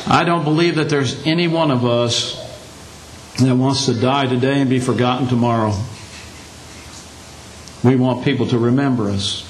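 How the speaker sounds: ordinary talk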